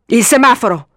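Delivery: urgent yell; shouting. A narrator's reading. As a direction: shout it out in sudden realisation. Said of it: "urlò Cousin".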